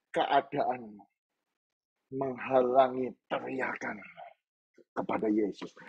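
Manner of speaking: usual speaking voice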